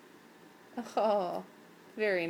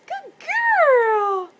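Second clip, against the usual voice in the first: very high, loud, drawn-out squeal; big cooing pet-talk cheer; gushing praise; 12 semitones higher, 14 dB louder than usual. Real, not acted.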